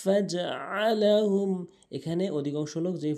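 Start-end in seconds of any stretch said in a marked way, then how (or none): 0.0-1.5 slow chanted ফাজআলাহুম, ain from mid-throat; correct ain. Shown as a demonstration. Teacher repeating the model, throaty ফাজআলাহুম. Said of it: "আইনের উচ্চারণ করতে হবে".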